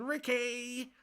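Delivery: high, drawn-out wail of the name; Bianca impression. Showing viewers the old impression, Bianca impression. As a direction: stretch the ending up high. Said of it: "the Bianca impression".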